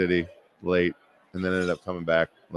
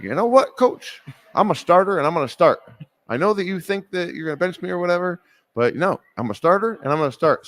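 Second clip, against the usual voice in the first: pumped-up bravado; 8 semitones higher than usual. Retold or imitated. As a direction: hype himself up to demand the start. Marty's bold advice, pumped up.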